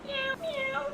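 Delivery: very high, soft kitty meows; kitten voice. Pretend. Cooing over the kitten token in a tiny high meow.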